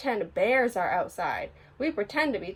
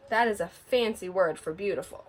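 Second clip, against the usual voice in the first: ordinary delivery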